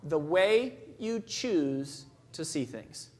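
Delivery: very slow, each word weighed; slow emphatic definition. Real, not acted. Speaker defines attitude for the audience, slow and deliberate. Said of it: "Attitude is simply".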